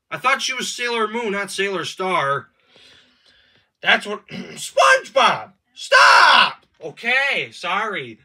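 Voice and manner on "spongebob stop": exasperated scream, stretching out the name. Acted. Game player yelling at SpongeBob for interrupting, screaming. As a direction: scream at him to stop. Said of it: "you screaming in my ear".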